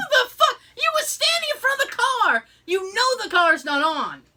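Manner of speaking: high, loud, laughing outrage; retold angry rant. Ranting at the clerk standing in front of the car, high angry disbelief. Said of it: "Oh, I was so mad".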